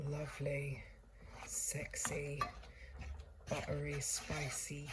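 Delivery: whispered, teasing; breathy sultry whisper. Pretend. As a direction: whisper it like a seductive secret. Sultry food description.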